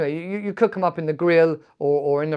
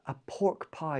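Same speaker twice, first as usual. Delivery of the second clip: softer echo of "A pork pie"; quiet repeat; 9 dB quieter than usual. Shown as a demonstration.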